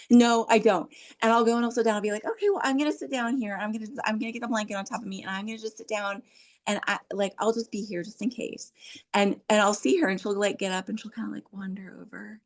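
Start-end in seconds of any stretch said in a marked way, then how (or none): 0.1-0.9 higher, loud protest; emphatic acted refusal; toddler's refusal. Grandmother voices toddler's refusal. 2.3-8.7 higher, softened grandma voice; light, unpressured coaxing; patient reassurance. Acted. Grandmother voices patient offer to toddler.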